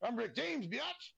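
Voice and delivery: high, squealing "biatch" at the end. Retold or imitated. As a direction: belt out the catchphrase like the star. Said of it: "Have you ever heard the saying".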